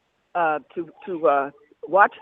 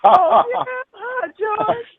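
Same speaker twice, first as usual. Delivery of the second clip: bright, much higher hello, a burst of delight; excited greeting; 6 dB louder, much slower than usual. Real, not acted. Singer greeting the London caller with delight.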